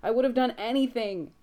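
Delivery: high, loud sob-choked cry; choking on sobs. A narrator's reading. Sam grieving over Dean's body, sobbing. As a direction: break on every word, no tears left. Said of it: "he's choking on his sobs".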